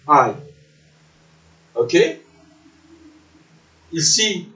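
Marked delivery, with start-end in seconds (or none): 0.1-0.3 I pronounced like English "eye"; I as diphthong. Shown as a demonstration. Teacher naming the diphthong for learners, I said as "eye". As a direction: glide from ah to ee on I. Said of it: "Nous avons la diphtongue".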